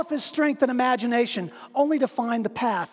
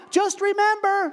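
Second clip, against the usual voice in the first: high, slow deliberate call; 10 semitones higher than usual. Acted. Dangling stranger calls up a grave reminder.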